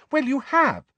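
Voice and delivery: high, loud indignant retort. A narrator's reading. Ginny answering Harry's denial, indignantly. Quarrel with Harry, accusing him.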